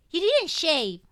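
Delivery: put-on high, loud toddler voice; a small child pointing it out; scolding little girl. A narrator's reading. Little girl rubbing her father's beard, gently scolding.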